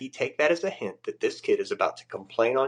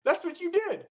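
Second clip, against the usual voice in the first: scolding chief. Retold or imitated. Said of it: "he was like".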